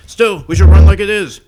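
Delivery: high, loud panicked yell; mock shout. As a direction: yell it like fleeing extras. Quoting a movie scene.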